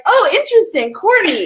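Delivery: high, amused "interesting"; mock distracted. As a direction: pretend to get sidetracked. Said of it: "I'm like".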